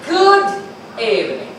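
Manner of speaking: "Good" high and loud, "evening" falling; lively inflection. Shown as a demonstration. Trainer showing inflection in a greeting, lively inflection. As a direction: sing out "Good" high, then drop on "evening".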